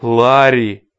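ordinary delivery

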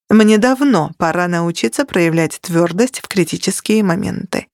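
usual speaking voice

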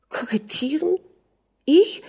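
high, rising echo of an accusation; incredulous. A narrator's reading. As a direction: question it in offended disbelief, pitch leaping up. Wife answers accusation of flirting.

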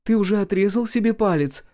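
called from the bedroom, high and loud; from another room. A narrator's reading. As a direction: shout it from down the hall. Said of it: "раздался из спальни голос Норы".

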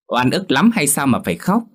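mock her tears with a pout; pouting sneer. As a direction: mistress sneering at the crying girl. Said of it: "liền bĩu môi".

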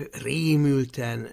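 plain reading voice

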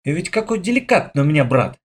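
ordinary delivery, nothing marked

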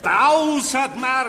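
langsam, hoch und laut; fassungslos. Acted. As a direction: entsetzt den Preis wiederholen. Fassungsloser Aufschrei. (fassungsloses, langsames Nachfragen)

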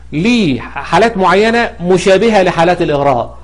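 ordinary talk, nothing marked

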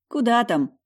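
plain reading voice

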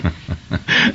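breathy fond chuckle; soft chuckle. Acted. Father to son, a soft chuckle. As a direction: laugh gently at the worry.

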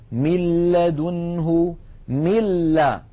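من لدنه recited slowly as "mil-ladunhu", straight onto a doubled l; doubled lam, no ghunna. Shown as a demonstration. Teacher showing merging without ghunna, doubled l in من لدنه. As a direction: drop the n of من and lean on the l of لدنه, no nasal hum. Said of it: "واللام عليها شدة وفتحة".

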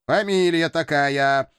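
goofy cartoon cat voice, slow; silly cartoon cat voice; Matroskin the cat. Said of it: "дурашливым голосом кота Матроскина".

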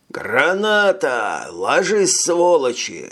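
high, slow bellowed warning; grenade warning yell. A narrator's reading. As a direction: roar it to clear the field. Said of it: "заорал".

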